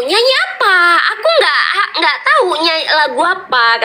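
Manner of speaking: high, giggly mock fluster; bashful protest. Aisar asked by crowd to sing, high flustered protest. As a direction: mimic him protesting, high and half laughing.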